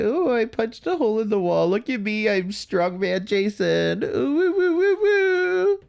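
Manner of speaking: high-pitched mocking singsong, trailing into long woos; cutesy mock Jason. Woman mocking a man who punched a wall, cutesy high voice. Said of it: "He thinks it's so goddamn fucking cute and quirky".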